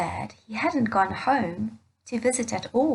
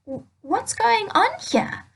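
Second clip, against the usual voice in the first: put-on higher, baffled young man asking; puzzled older son; 10 semitones higher than usual. Older son asking his father about the party, baffled. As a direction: demand to know what the fuss is.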